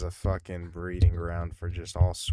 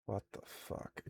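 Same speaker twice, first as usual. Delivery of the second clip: whispered disbelief; 14 dB quieter than usual. Real, not acted. Reacting to a viewer application.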